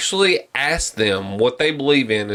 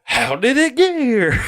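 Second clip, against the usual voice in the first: high-pitched movie-quote holler; drill sergeant; 12 semitones higher than usual. Acted. Quoting Full Metal Jacket, drill sergeant impression. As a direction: imitate an incredulous sergeant, pitched way up. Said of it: "fucking Full Metal Jacket".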